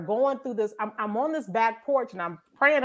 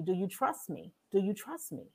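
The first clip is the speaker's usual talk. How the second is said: soft voice of God; 3 semitones lower, 11 dB quieter than usual. Retold or imitated. God's voice in her story.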